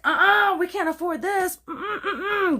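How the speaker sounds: high, whiny mock of the husband refusing; high nasal-free whine, refusing the baby. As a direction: squeak it out like a sulky man-child.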